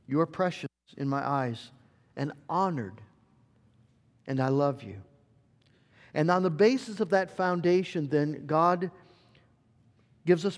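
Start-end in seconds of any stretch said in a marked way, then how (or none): 0.1-5.0 gentle reassurance. Acted. God answering doubts gently.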